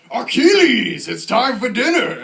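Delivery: mock-epic high, loud shout, name stretched; hammed-up theatrical bellow; bellowing dad. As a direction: call a kid to dinner like a movie warlord. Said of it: "what if a kid nowadays was named".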